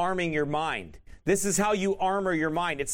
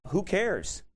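normal talking voice

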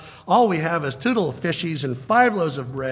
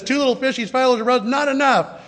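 higher, fretful listing; whiny disciple; 7 semitones higher than usual. Retold or imitated. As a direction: count the meagre food in despair. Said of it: "The other one says".

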